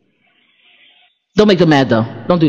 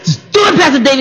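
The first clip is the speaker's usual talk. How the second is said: high-pitched mock urging; urging plea; 3 semitones higher than usual. Goading Pastor David to yell at her, pleading. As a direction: egg someone on, pitch jumping up.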